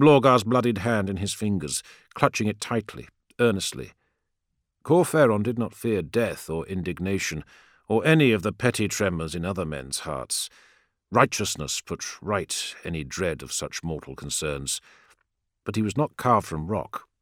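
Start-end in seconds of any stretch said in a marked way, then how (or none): none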